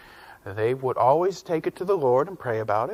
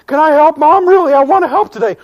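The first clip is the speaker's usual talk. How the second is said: much higher and louder, rushing eagerly; overeager begging; 11 semitones higher, 11 dB louder than usual. Acted. Boy pestering mom to help cook, overeager and loud. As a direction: plead with bouncy eagerness.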